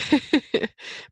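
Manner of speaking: an airy, high laugh with no voice; breathy laugh. Real, not acted. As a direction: let out a breathy, moved laugh.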